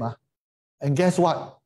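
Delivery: ordinary delivery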